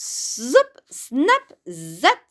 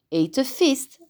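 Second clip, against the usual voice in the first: plain reading voice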